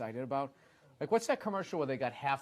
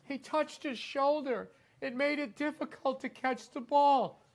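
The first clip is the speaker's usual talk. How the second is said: mock referee; 10 semitones higher, slower than usual. Mocking referee's explanation.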